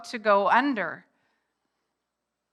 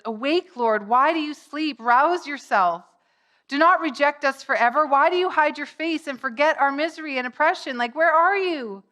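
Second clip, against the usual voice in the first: higher, louder cry to God; urgent pleading; 5 semitones higher, 5 dB louder than usual. Acted. Psalmist crying to an absent God, urgent pleading. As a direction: cry out a desperate plea to a silent God.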